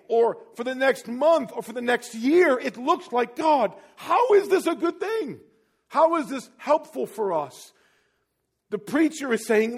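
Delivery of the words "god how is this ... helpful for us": high, loud, drawn-out plea; anguished questioning. Acted. Believer questions God how this is good, anguished plea. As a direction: cry it out slowly toward heaven.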